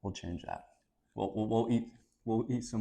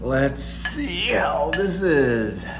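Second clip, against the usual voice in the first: slow, loud announcer-like drawl; slow dramatic build-up; 6 semitones higher, 7 dB louder, much slower than usual. Acted. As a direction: stretch it out, big and dramatic. Dramatic slow taste test lead-in.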